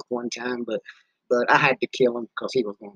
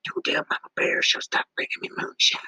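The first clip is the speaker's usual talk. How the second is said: whisper; 11 semitones higher, 5 dB quieter than usual. Acted. Secret kept from Mama Bear.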